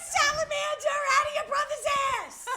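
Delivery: put-on screamed very high and loud at the kids; frantic mom yell. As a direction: yell it in full panic at a misbehaving son. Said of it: "And I'm like".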